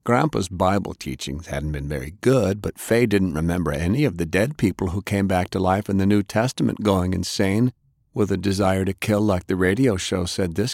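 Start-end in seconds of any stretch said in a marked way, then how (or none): none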